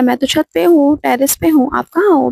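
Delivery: excited answer, pitched high; calling out on phone. Retold or imitated. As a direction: call out where you are, up on the terrace.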